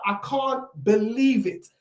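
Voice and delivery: ordinary talk, nothing marked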